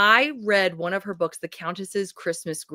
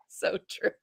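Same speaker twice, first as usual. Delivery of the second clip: laughing; 7 semitones higher, much slower than usual. Real, not acted. Laughing fit between words.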